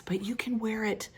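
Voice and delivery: hushed confiding whisper. Pretend. Telling viewers about her manor fantasy, whispered. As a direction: whisper it like a little secret.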